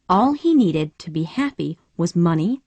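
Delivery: usual speaking voice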